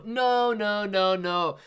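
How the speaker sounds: loud, high, animated denial; anything but monotone. Shown as a demonstration. Streamer rebuts a monotone voice jibe, animated protest. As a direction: push the voice up, lively and emphatic. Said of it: "There's no monotony in this voice".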